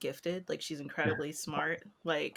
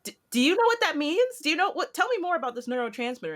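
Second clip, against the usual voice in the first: much higher, louder, fast amazed voice; high, fast fake amazement at the child; amazed gushing; 10 semitones higher, 4 dB louder than usual. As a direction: gush at the kid as if stunned. Amazed reply to a gifted child.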